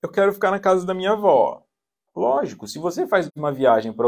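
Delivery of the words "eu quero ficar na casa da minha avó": put-on high, loud childish demand; bluffing child. Child threatening to move in with father or grandmother, high mock tantrum. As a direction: play a child throwing a tantrum. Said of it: "A criança blefe, ela fala".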